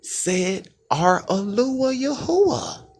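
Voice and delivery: slow, high, ringing pulpit proclamation; stretched, exalted declaration; proclaiming the name. Acted. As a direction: lift the name up and draw it out like a herald. Preacher proclaiming the divine speaker.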